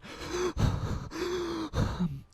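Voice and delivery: high, gasping breaths imitated; mock hyperventilating. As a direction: imitate a mother hyperventilating on the phone. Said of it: "She's hyperventilating".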